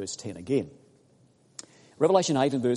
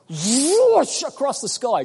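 slow, high, sweeping whoosh; sound-effect whoosh; drawn-out whoosh; 10 semitones higher, 6 dB louder, slower than usual. Acted. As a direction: stretch it like a streak across the sky. Acting out a meteor.

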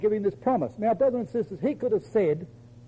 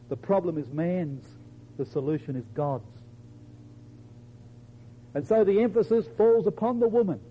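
ordinary talk

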